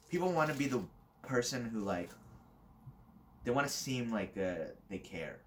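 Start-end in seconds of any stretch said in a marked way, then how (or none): none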